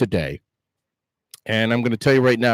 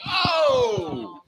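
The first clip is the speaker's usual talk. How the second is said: surprised oh; 4 dB quieter than usual. Real, not acted. Reacts to "I don't drink soda.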